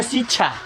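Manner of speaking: शिक्षा said as sichha, without the ksh; imitating the wrong शिक्षा: sichha; mock wrong sikchha for शिक्षा; common mistake. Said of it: "कितना लोग इसे पढ़ते हैं".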